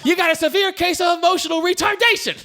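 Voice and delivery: high-pitched mock yelling; imitating yelled argument. Retelling the movie couple's fight, mock yelling. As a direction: quote the insult like a screaming match. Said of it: "so they're yelling at each other".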